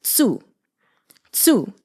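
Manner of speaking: tsu with the ts of cats and a u; tsu, as cats plus u; tsu sound. Shown as a demonstration. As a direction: say ts then u, tsu. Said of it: "put u after it".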